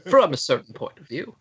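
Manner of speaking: quick put-on Obi-Wan voice; a sly Obi-Wan dodge; mock Obi-Wan quote. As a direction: rattle it off as a smug excuse. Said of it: "Obi-Wan's like".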